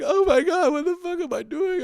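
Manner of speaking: high wailing mock sob; imitating crying. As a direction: wail it like a kid bawling over a fish. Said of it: "And then I start to cry".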